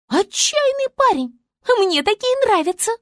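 заметно выше, капризно-важно; звёздочка поучает зверей; голос Звезды. A narrator's reading. Звезда одобряет. (Звезда важно объясняет, высокий голос)

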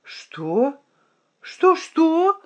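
spluttering disbelief, jumping up high; the knight chokes on his wine; choking in surprise. A narrator's reading. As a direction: caught off guard and spluttering. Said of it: "поперхнулся гонец".

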